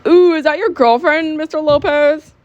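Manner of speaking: high, drawn-out ooh of teasing; teasing classmate. Acted. Classmate teasing teacher in story.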